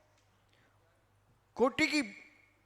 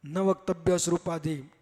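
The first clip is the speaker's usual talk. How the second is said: measured, loud scriptural chant; Sanskrit verse recitation; 4 semitones lower, 8 dB louder than usual. Acted. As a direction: recite the verse firmly, line by line.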